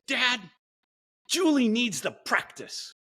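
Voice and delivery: imitating daughter. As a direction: play the daughter sticking up for her teammate. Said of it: "and she said".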